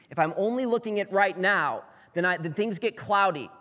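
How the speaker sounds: rising preacher voice. Real, not acted. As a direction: lift the voice to warn the congregation.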